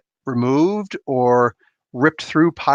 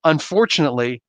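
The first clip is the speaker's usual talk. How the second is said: ordinary delivery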